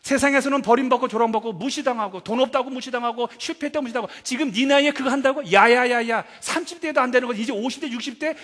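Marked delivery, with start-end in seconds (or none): 4.2-8.4 high-pitched, heated mock scolding; acted-out dismissive harangue; scornful belittling. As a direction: pour scorn on someone's foolish dream. Voices the world scorning a dreamer.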